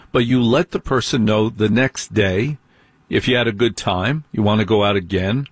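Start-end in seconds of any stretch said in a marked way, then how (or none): none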